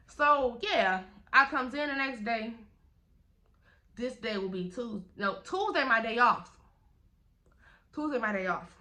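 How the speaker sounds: usual speaking voice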